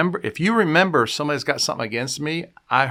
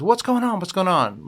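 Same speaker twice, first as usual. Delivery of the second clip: sharp, high worried call from another room; a mom calling out high at the scream; alarmed mom calling out; 7 semitones higher than usual. Retold or imitated. Mom hears her kids scream, calls out alarmed.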